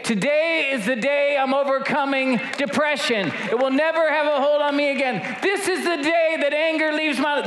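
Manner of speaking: high, ringing preacher's cry building to a shout; full-force preaching. Acted. Preacher rallying the congregation against their giants, full force. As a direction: preach it out to the whole church.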